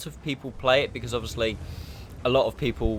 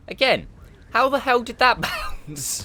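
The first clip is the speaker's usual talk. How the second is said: laughing disbelief; 11 semitones higher, 4 dB louder than usual. Real, not acted. Disbelief at a bounced shot.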